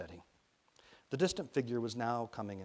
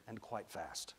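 muttered; 10 dB quieter, slower than usual. A narrator's reading. Rennie watching her fall at an angle, muttered. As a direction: mutter it under your breath. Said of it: "Rennie muttered".

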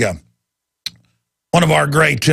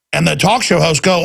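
usual speaking voice

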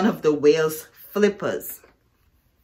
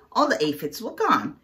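ordinary delivery, nothing marked

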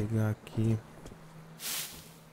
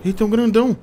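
high, loud startled cry; startled shout; 10 semitones higher, 9 dB louder than usual. Real, not acted. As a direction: jump at the huge monster. Giant monster appears.

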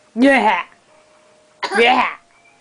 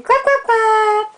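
high, slow, cheering clap clap; 9 semitones higher, slower than usual. Real, not acted. High excited cheer to baby.

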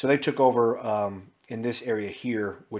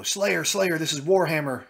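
radio call; 6 semitones higher, 4 dB louder than usual. Retold or imitated. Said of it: "they're like".

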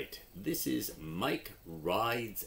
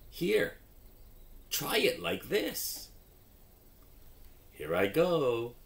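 lively storybook read with character voices; reading to children; 7 semitones higher, 6 dB louder than usual. Acted. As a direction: act out the story for young readers. Reads phonics story with voices.

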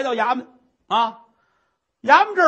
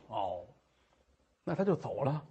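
low dry murmur; 10 semitones lower, 13 dB quieter than usual. Acted.

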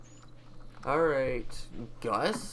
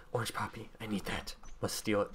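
usual speaking voice

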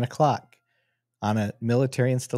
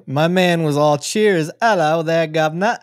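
put-on British accent; 5 semitones higher, 5 dB louder than usual. Vlogger mocking a polite officer, British accent. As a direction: play a cheery London bobby in a British accent. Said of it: "You like that accent?".

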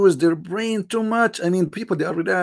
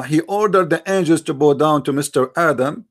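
ordinary talk

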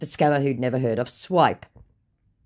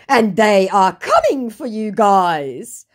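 loud, slow, higher-pitched warning; mock menacing scammer; 7 semitones higher, 8 dB louder, slower than usual. Scammer warning that they are coming, mock menacing. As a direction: draw out a hammed-up threat.

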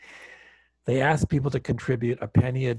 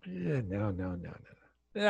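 soft, falling brush-off; dismissive; 4 semitones lower, 8 dB quieter than usual. Retold or imitated. Teller dismisses his own sudden thought, softly dismissive. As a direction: wave the idea away under your voice. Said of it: "Dismiss the thought".

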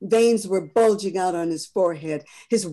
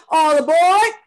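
a much higher, much louder call down the platform; conductor's call; 11 semitones higher, 12 dB louder than usual. Acted. Conductor calling passengers to board, loud and high. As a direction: call out all aboard like a railroad man.

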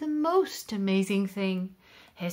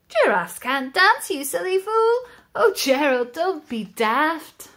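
higher, louder mocking chant; taunting animals; 5 semitones higher, 5 dB louder than usual. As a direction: sneer at the clumsy giraffe. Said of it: "The animals all laughed".

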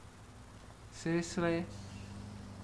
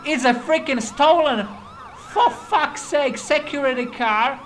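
loud, higher, stretched-out shock; realising mid-chase that the car is stolen; excited outburst; 7 semitones higher, 6 dB louder than usual. Real, not acted. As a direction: blurt it out in disbelief.

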